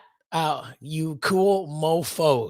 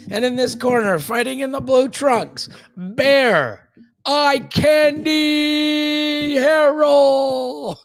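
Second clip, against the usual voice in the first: booming fight announcer, drawn out; big boxing-ring introduction, slow and loud; 8 semitones higher, 5 dB louder, slower than usual. Retold or imitated. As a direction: stretch the name like a UFC ring announcer. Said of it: "It's like when you come out ... the U F C".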